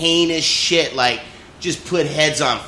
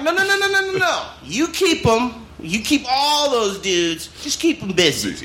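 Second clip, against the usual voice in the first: mock officer; 8 semitones higher than usual. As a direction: plead to keep the squad out there. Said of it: "I'm like".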